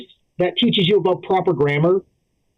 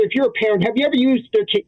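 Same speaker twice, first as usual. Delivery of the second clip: ordinary delivery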